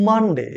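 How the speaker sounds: মানডে with an open "a", "man-de"; corrected Monday. Shown as a demonstration. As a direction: say মানডে with first vowel "ah", not "o". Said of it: "কি হবে?". (মানডে-র সঠিক উচ্চারণ, "মান-ডে")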